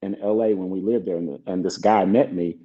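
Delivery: ordinary talk, nothing marked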